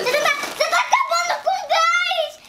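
high squeaky voice; squeaky, half-laughing; helium voice. Real, not acted. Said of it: "Eu tô comendo ar".

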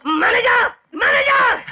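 high, loud, near-tearful yelling denial; furious shout. Acted. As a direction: scream that you're not angry while losing it. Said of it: "और आप कह रहे हैं आपको गुस्सा नहीं आ रहा है?". (ऊँची, तेज़ आवाज़ में गुस्से से चीखना)

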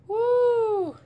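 high loud cheer; joyful whoop; excited woo. Real, not acted. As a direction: whoop for the camera. Hype outburst.

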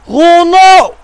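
big game shout at the screen; excited shout. Real, not acted. Gamer shouting a player's name at the screen. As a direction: yell the name out high and loud as he shoots. FIFA gameplay, big shot.

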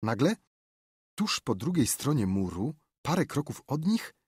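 ordinary delivery

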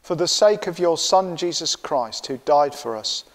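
ordinary talk, nothing marked

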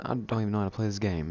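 usual speaking voice

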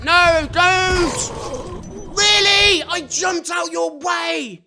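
a real exasperated holler; outraged shout. Real, not acted. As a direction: yell in outrage, stretching it out slowly. Companion jumps into danger.

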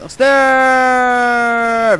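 high loud yell of Ster. Real, not acted. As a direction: shout at the zombie. Schreeuwt naar zombie. (luide hoge kreet)

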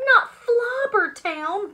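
loud, high, drawn-out cry of dismay; slow, appalled group shout; shouted protest. Acted. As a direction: shout it in horror. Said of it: "we shouted".

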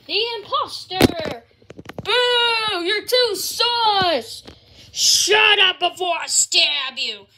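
loud angry shout at the hero; shouting villain. Acted. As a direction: yell the threat.

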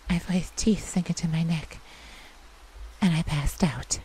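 ordinary delivery

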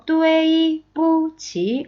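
뚜에이, 뿌치 held high and level; toneless pronunciation. Shown as a demonstration. Teacher repeating easy sounds, 뚜에이 뿌치 high and level. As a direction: sound out 뚜에이, 뿌치 without tones. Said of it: "어려울 발음 전혀 없고".

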